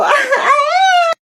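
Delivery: long high squealing laugh; high, lovestruck shriek; giddy squeal. Acted. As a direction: squeal at your own pickup line. Squeals after pickup line.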